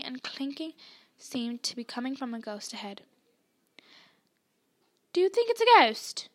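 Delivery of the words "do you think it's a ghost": nervous question. A narrator's reading. Nervous question in a dark passage.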